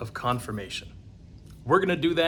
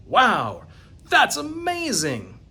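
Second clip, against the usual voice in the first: much higher, slow, drawn-out wonder; amazed wow; 7 semitones higher, 4 dB louder, slower than usual. Retold or imitated. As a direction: marvel out loud at a miracle. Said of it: "You'd think he might say something like".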